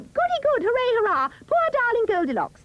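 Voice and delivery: put-on high, loud cheering child voices; children shouting. A narrator's reading. Little children cheering the villain's escape, high and loud. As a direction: squeal it like delighted little kids. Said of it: "tiny children near and far shout".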